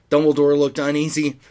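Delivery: usual speaking voice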